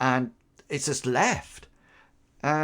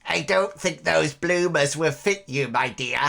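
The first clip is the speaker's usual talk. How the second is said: wheedling crackly crone voice; lightly voiced toothless old woman; old hag. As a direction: coax like a cheeky old crone. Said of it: "this toothless hag of a woman".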